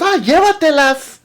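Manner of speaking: high, loud take them, Spanish then English; mock generous seller; generous old seller. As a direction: wave the cards off to him. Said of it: "He goes".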